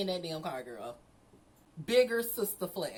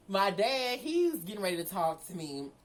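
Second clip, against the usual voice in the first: normal talking voice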